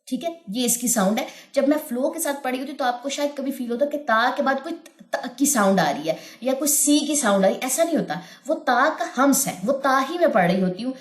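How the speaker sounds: usual speaking voice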